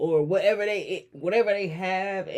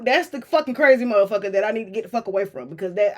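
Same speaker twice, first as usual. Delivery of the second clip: ordinary delivery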